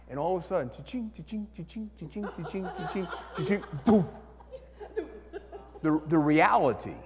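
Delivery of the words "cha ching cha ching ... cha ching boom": soft imitated ringing clinks ending in a boom; playful mimicked jackpot chimes; cha-ching coin sound. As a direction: click it out like a payphone taking the coin. Imitating payphone coin drop.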